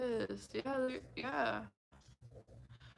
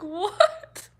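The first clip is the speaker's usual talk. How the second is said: higher, louder disbelieving what; loud shocked what; 8 semitones higher, 12 dB louder than usual. Retold or imitated. Reacting to outlandish strangers, loud mock shock. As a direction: yelp it in disbelief. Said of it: "You're like".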